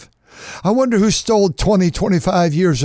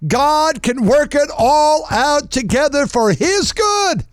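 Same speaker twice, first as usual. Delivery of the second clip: high, slow preaching climax; 10 semitones higher, slower than usual. Acted. Preacher to congregation, high slow climax. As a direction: land the promise high and slow.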